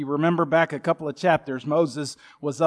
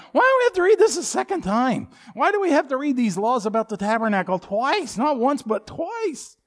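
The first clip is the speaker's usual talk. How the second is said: whiny complaining congregant; 8 semitones higher than usual. Retold or imitated. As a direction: mimic bored readers whining. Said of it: "people would get to that part".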